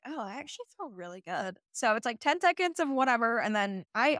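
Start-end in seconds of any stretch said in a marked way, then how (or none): 0.0-1.6 soft, quiet pleasant surprise; pleasantly surprised. Retold or imitated. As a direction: sound surprised it feels good. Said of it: "you're like".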